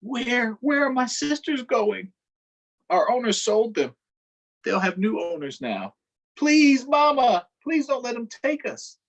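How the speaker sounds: put-on high, desperate child begging; frightened pleading. Enslaved girl begs her mother to stop the sale, frightened high plea. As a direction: plead with mama not to let them go.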